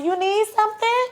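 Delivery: high, sugary nurse imitation; high-pitched helpful lilt; sweet nurse. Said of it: "Sweet lady came in there".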